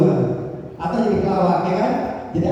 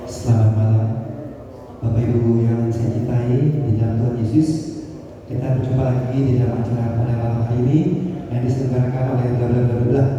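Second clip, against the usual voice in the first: low, steady, breath-controlled greeting; calm formal MC; 7 semitones lower than usual. Shown as a demonstration. MC opening an evening church event, calm formal intonation. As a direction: let the breath out gradually, low and even. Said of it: "kita bicara lebih tenang lagi".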